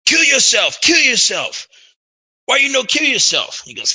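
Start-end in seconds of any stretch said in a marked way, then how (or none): 0.1-0.8 high, loud furious command; mock furious chief; angry chieftain yell. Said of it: "the chief just gets pissed". 0.8-3.6 high, loud furious command; mock furious chief; angry chieftain yell. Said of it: "the chief just gets pissed".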